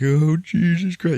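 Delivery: high, loud, drawn-out wail; hammed-up tearful moan; mock crying. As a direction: imitate a man crying in despair. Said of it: "He's crying and shit".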